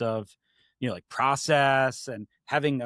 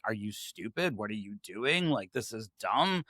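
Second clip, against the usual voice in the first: low, quiet, matter-of-fact put-down; imitating a bluntly plain boss, softly; blunt, plain-spoken; 4 semitones lower, 7 dB quieter than usual. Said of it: "I tended to speak to them very plainly".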